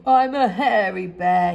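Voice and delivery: a sad bear on the edge of tears; sobbing. A narrator's reading. Boris sobbing alone, lightly voiced. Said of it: "he sobbed".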